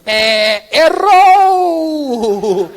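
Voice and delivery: high, loud, long-drawn game-show verdict; comically booming rejection; mock buzzer shout. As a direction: blare it out like a wrong-answer buzzer, stretching the last vowel. God's buzzer-like verdict on answer.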